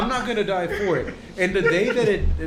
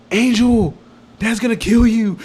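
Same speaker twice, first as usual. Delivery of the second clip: higher, louder imitation of an alarmed sister; sister calling out; 5 semitones higher, 9 dB louder than usual. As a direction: call out the warning as the sister. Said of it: "my sister's like".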